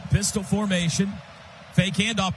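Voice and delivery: normal talking voice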